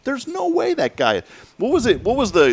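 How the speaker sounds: can't believe what you just read; incredulous. Real, not acted. Host reacting to a star's much younger girlfriend, incredulous. Host shocked at age gap.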